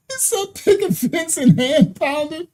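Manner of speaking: high, giggly quoting; helpless laughter; laughing. Real, not acted. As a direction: repeat the line while laughing. Cracking up quoting.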